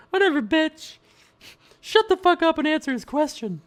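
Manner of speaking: high squeaky furious nerd; angry nerd voice. Pretend. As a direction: snap it out like a raging nerd. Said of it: "angry nerd voice".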